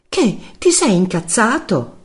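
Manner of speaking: high, slow, challenging woman's voice; needling woman. Acted. Giulia lo provoca al telefono. (voce acuta di donna, provocatoria)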